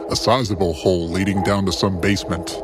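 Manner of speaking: usual speaking voice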